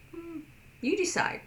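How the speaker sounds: high, light "you decide" shrug; easygoing indifference. Retold or imitated. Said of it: "But a deaf person would say".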